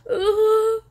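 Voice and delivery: put-on a weepy old lady getting emotional; old woman. Old horse trader getting teary with a customer in an old woman voice. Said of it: "pretend like an old woman".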